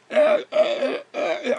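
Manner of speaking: high, strained uh with frozen lips; frozen stammer. Retold or imitated. Shivering in the cold, stammering high. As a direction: choke out sounds through numb lips.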